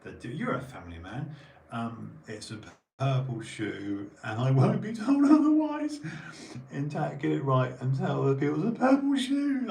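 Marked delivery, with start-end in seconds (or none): 3.0-9.7 high, loud, laughing mock insistence; hammed-up defiance; mock outrage. Mocking stubborn belief, high loud laughing insistence.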